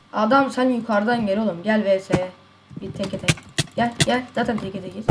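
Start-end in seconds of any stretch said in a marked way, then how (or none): none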